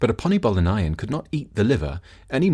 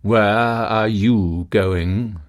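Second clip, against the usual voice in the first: the lightning's voice; 4 dB louder, much slower than usual. Acted. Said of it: "asked the lightning".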